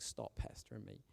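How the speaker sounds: breathy whispered mock complaint. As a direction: whisper it like an imagined grumble. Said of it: "she must have been like".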